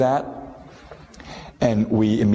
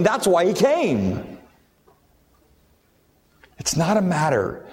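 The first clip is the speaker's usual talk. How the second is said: impassioned sermon climb, much higher and ringing; preaching at full force; 9 semitones higher than usual. Acted. Pastor driving home God's willingness to the congregation, fervent preaching. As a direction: lift the congregation to the peak of the point.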